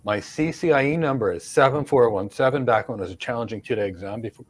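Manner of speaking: usual speaking voice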